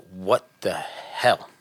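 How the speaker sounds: slow, low, drawn-out disbelief; slow, stunned mock reaction; mock bewilderment. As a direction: imitate a stunned onlooker, word by word. Imitating ex-wife's bewildered look.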